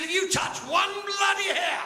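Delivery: high, drawn-out outraged threat; mock-indignant patient. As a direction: threaten them in a high, stretched voice. Patient threatens to sue in joke.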